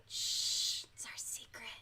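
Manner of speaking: hushed, conspiratorial whisper; playful whisper. Pretend. Telling viewers not to tell the boss, playful whisper. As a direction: shush them, then whisper.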